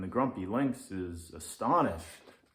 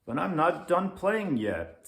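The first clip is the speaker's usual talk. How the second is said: put-on high, eager little boy voice; little kid; 8 semitones higher, 6 dB louder than usual. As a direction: pipe it up like a small child. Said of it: "says little Leo".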